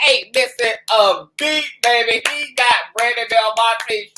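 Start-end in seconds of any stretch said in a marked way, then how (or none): none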